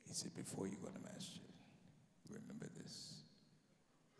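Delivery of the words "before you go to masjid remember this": unvoiced hushed build-up; whisper. Guard whispering before the big reveal. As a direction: whisper it like a sacred secret.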